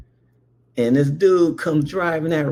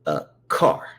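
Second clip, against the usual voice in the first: put-on lower, matter-of-fact kid; lightly voiced know-it-all; 10 semitones lower than usual. Brother explaining the word, low and certain. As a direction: state it flatly as fact. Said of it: "And he goes".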